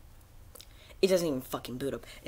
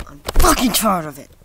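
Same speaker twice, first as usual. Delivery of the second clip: loud angry yell of frustration; furious shout; 14 dB louder than usual. Real, not acted. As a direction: blow up, completely fed up. Rant about crashing servers.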